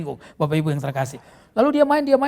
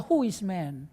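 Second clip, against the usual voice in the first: "Who is man?" said soft and dismissive, falling at the end; a young woman shrugging off suitors; choosy young woman; 6 semitones lower, 6 dB quieter, slower than usual. Retold or imitated. Preacher mimics a twenty-year-old woman, softly dismissive. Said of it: "dia berkata".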